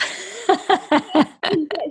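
bright high laugh. Real, not acted. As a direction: laugh it off, amused. Laughs at cheap labour joke.

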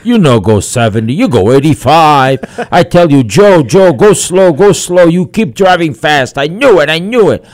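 high, loud scolding wife voice; nagging wife. Acted. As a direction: scold your husband loudly, pitch climbing as you go.

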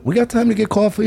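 high-pitched hopeful ask; eager retold question. Asking friend for coffee as Uber approaches, high eager question.

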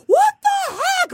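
very high, drawn-out mock shout; exasperated outcry. Reacting to being rear-ended, exasperated outcry. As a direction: wail it in comic disbelief.

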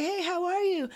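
imitating a high-pitched friendly hello; retold upbeat driver greeting. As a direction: greet a passenger, pitched well up. Retold driver greets her cheerfully.

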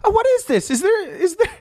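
high squeaky taster voice. Acted. High voice tasting food.

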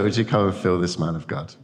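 usual speaking voice

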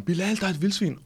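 mock warning cry, pitched high without full volume; high-pitched, held-back yell from the back seat; retold shout. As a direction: imitate a friend calling out a sudden warning. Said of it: "og så råber han".

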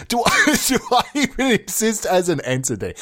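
high, laughing through the words; real giggle breaking into the question. Real, not acted. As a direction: crack up while asking it. Cracks up repeating own absurd question.

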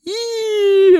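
high, loud wailing howl; eerie animal cry. Acted. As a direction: wail it like a distant creature.

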